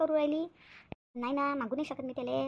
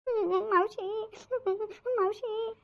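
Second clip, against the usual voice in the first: slow, higher drawn-out wail; fake wailing; 6 semitones higher, much slower than usual. Chandrika wails for her sick aunt to get money, slow put-on wail. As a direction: wail the aunt's name to win sympathy. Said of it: "एवढी रडू नको".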